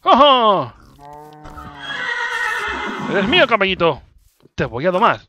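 high, loud triumph; triumphant. Real, not acted. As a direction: crow over the catch. Triumphant catching horse.

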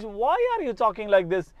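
ordinary talk

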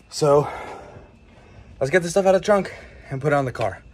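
breathless and winded from the heat; puffing between words; out of breath. Real, not acted. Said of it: "I'm already out of breath".

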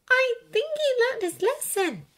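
high-pitched, careful suggestion; tentative. A narrator's reading. Hermione defending Ron to Draco, tentative. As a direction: offer it timidly in a high voice. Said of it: "Hermione said tentatively".

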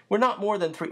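plain reading voice

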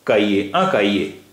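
"काइये" with no H sound before the final vowels; silent H. Shown as a demonstration. Teacher shows H is always silent, "काइये" said without it. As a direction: glide through "काइये" without any H. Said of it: "एच हमेशा silent रहता है".

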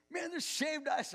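put-on boy spotting the treat, thrilled; excited boy. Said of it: "and he goes".

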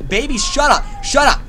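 loud high yell; yelling shut up. Real, not acted. Gamer yelling at screaming creature, loud. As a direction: shout at it to stop.

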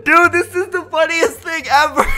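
high, loud through laughter; cracking up. Real, not acted. Prankster can't believe the victim is obeying, cracking up. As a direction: squeal it out while laughing.

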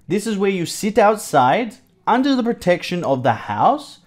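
ordinary talk

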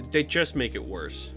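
ordinary talk

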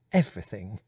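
plain reading voice

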